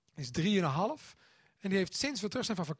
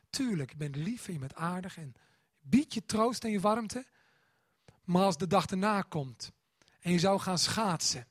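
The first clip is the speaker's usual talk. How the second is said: ordinary talk, nothing marked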